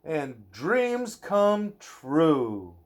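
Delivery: lilting bedtime-story read-aloud; reading to a child. Acted. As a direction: read it gently to a little one at bedtime. Reading a picture book aloud.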